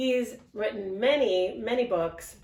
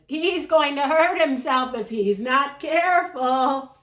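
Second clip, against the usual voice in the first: higher, louder fretting voice; worried sheep; 7 semitones higher, 6 dB louder than usual. Acted. Sheep worrying about Duck, higher fretting voice. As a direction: fuss like a nervous old sheep. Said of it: "we have to use the voices".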